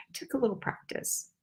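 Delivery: ordinary talk, nothing marked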